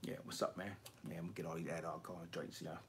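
hushed, low mock mumble, hurried; quiet mumbling impression. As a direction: imitate a very soft-spoken collector. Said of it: "He do talk quiet".

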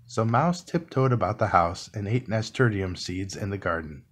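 ordinary delivery

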